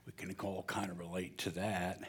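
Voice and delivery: hushed unvoiced aside to the crowd; whispered aside. Real, not acted. Pastor whispers a joke to the congregation.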